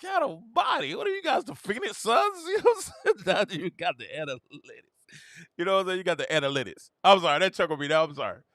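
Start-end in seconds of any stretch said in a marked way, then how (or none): 0.0-2.4 high, incredulous teasing; mock exasperation. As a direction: scoff at their math. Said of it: "I'm like".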